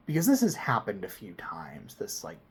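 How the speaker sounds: usual speaking voice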